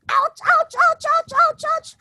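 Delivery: high, loud ouches; crying out in pain. Acted. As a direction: yelp at every thorn prick. Monkey pricked by thorns.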